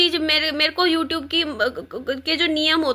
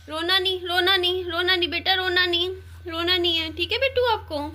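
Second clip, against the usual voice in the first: higher, gentle hushing to stop a little one crying; 4 semitones higher than usual. Real, not acted. Mother soothing her small child who wants the AC, tender and hushing.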